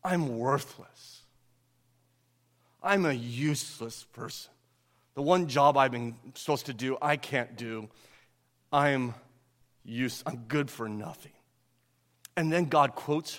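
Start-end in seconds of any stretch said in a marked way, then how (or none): 0.0-11.3 self-hating. Retold or imitated. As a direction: say it as if you feel worthless, softly. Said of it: "you hear the self-hatred?".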